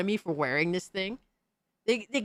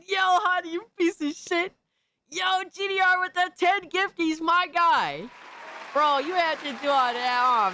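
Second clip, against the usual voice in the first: excited shout; 12 semitones higher than usual. Real, not acted. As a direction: yell with joy at a big gift. Thanking a big gifter.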